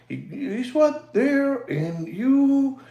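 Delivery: lightly mocking a calm TV-style host; imitating his voice. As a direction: mimic a hushed, measured presenter voice. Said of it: "he talks like that".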